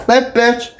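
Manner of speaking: sudden high-pitched yelped words; high, abrupt Tourette's outburst; vocal tic. Real, not acted. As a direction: blurt it out involuntarily, very high. Involuntary verbal Tourette's tic.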